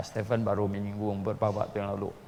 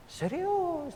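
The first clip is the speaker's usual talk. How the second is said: high, insistent. Retold or imitated. Insists to the neighbour.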